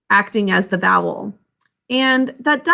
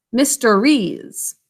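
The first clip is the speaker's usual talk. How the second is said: slow model word; much slower than usual. Shown as a demonstration. Teacher models new plural.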